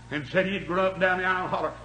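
rising and falling revival preacher, shouting peaks; fiery preaching. Acted. Revival preacher to his congregation, fiery preaching.

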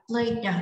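"play đờ" as "played", the d sounded out; đuôi -ed đọc /d/. Shown as a demonstration. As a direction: end "play đờ" on a separate voiced "đờ". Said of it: "đuôi này sẽ đọc là". (đọc "play đờ" thành "played", bật rõ âm đờ)

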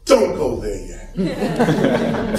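drawn out, unhurried; slow it right down; slow and deliberate. Real, not acted. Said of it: "I gotta go slow".

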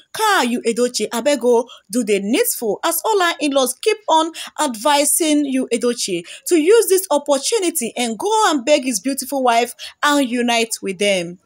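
ordinary delivery

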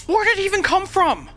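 very high frantic yelps of panic; panicked. Real, not acted. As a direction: freak out, voice shooting up. Sudden panic at a threat.

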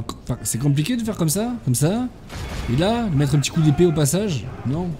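ordinary delivery

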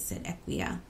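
ordinary delivery

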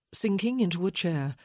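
ordinary talk, nothing marked

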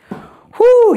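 loud, high "whoo"; high-pitched burst of workout joy; excited whoop. Real, not acted. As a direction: cheer out while dancing along. Whoop while dancing the steps.

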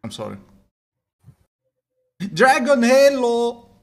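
ordinary talk, nothing marked

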